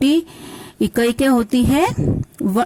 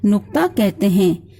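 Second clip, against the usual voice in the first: ordinary delivery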